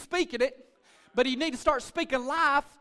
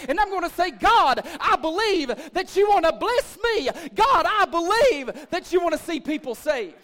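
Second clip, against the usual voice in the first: loud, higher-pitched declaration to God; fervent preaching; 5 semitones higher, 6 dB louder than usual. Real, not acted. Pastor modeling a believer's prayer of faith, fervent preaching. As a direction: cry out your belief with force.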